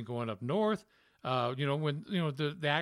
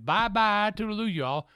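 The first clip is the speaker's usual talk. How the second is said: higher, louder singsong Southern goodbye; a cheery on-air farewell, Southern style; radio sign-off; 7 semitones higher, 4 dB louder than usual. Retold or imitated. Morning radio host signing off to listeners, cheery Southern goodbye. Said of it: "I remember you saying".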